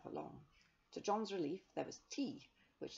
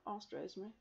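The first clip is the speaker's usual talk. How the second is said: plain reading voice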